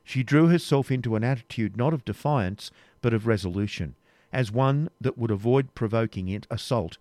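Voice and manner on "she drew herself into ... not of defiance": ordinary delivery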